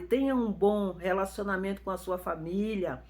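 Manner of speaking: normal talking voice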